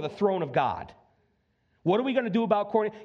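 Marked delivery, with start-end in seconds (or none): 1.8-3.0 rushed musing. Retold or imitated. Heaven voiced deliberating.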